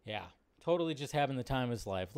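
normal talking voice

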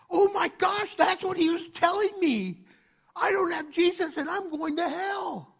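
doctor suddenly realizing his fate, alarmed; panicked realization. Retold or imitated. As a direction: let the dread hit all at once.

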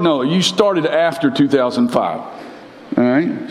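usual speaking voice

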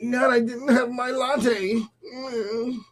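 high, slow, pretend-crying complaint; hammed-up pitiful whine; mock whining. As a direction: whimper it like a spoiled excuse-maker. Mocking pampered believer's petty excuse.

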